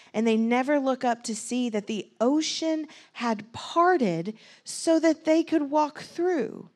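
ordinary delivery

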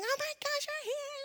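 very high mock squeal, held back in volume; obnoxious excited greeting. Showing how she greeted friends, high obnoxious squealing. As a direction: re-enact gushing over friends, high and silly. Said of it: "it's obnoxious".

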